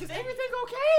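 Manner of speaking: mock mother. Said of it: "mocking the mother's voice".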